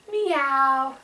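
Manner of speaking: put-on drawn-out meow for a toddler to copy; cat meow. Shown as a demonstration. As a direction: meow like a kitty. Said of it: "Can you say meow?".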